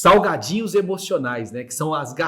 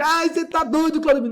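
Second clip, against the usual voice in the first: high-pitched mock alarm; alarmed naysayer; 11 semitones higher than usual. Someone reacting to his balloon plan, high mock alarm. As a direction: squeal it like a scandalised friend.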